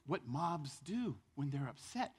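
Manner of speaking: usual speaking voice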